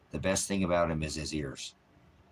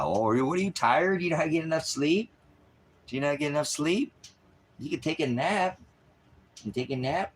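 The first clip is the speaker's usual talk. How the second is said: sing-song doggy voice, pitched way up; cooing pet talk; 9 semitones higher than usual. Real, not acted. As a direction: coo at a sleepy dog. Cooing questions at his dog.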